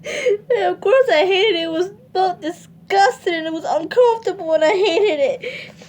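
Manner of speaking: words pushed out through tears; breaking down crying. Real, not acted. Recounting the assault to viewers, crying for real.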